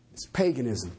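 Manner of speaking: "paganism" dropped to a very low pitch; low, understated. Real, not acted. Preacher letting the verdict sink in, low "paganism". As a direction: repeat the verdict low and matter-of-fact, "paganism" unstretched.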